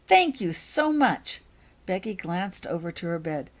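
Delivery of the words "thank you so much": heartfelt thanks. Acted. Becky thanking best friend.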